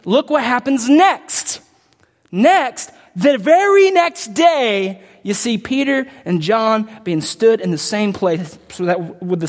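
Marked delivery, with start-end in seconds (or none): 0.0-5.0 slow, stressed "next" and "very"; slow deliberate stress on "the very next day"; emphasis on next. Real, not acted. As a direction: lean hard on "next day". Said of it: "give specific emphasis".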